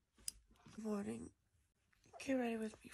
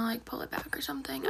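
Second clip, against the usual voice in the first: whispering; 3 semitones higher, 8 dB louder than usual. Real, not acted. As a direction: keep it all in a whisper. Whispers the whole video.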